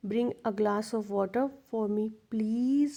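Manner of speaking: very slow request with the tune rising on please; polite request tune. Shown as a demonstration. Teacher contrasts a polite request with a command. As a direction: ask gently and let please rise. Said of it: "request होती तो rising intonation होती".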